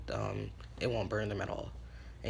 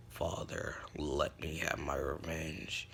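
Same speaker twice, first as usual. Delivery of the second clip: low, soft, slow plea in character; brooding, breathy low voice; deeper voice; 7 semitones lower, 4 dB quieter, slower than usual. Acted. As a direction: sink deep and hush the demand. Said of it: "his voice got deeper".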